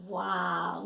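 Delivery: usual speaking voice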